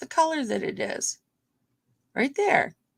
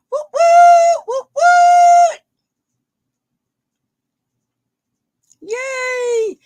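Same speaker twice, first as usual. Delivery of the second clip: much higher, louder whooping cheer; cheering whoops; 13 dB louder, much slower than usual. Acted. Seller cheering buyers on in a much higher, louder whoop. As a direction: whoop it up like a party horn. Cheering during a live sale.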